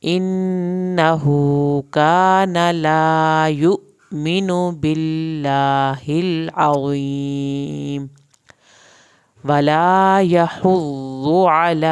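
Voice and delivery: stretched tajweed recitation; Quran recitation. Shown as a demonstration. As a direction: chant it out slowly with a full heavy ṭā. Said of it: "ہا کو حلق سے پڑھیں گے".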